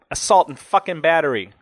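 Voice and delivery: bitter, worked-up incredulity; indignant disbelief. A narrator's reading. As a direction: come down from the outburst, still fuming. End of rant, angry snort follows.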